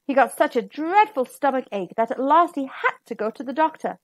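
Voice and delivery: plain reading voice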